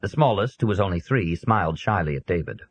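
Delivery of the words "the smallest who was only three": ordinary delivery, nothing marked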